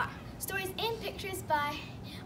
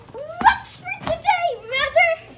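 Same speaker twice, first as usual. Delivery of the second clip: high, loud and slow eager question; eager child; 8 semitones higher, 11 dB louder, much slower than usual. Acted. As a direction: ask your mother excitedly. Child Max asks mother eagerly.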